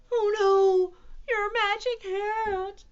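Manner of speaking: high, loud wail; distraught cats; dismayed cry. A narrator's reading. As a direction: cry out, dragging the last word. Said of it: "cried the cats".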